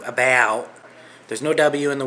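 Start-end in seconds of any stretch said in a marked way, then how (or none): 0.0-0.7 loud, exaggerated American "about", a wide "a-bay-owt". Shown as a demonstration. Canadian showing how Americans say it, mock American "about". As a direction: say "about" with a broad, flattened American vowel. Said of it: "the way that the Americans do".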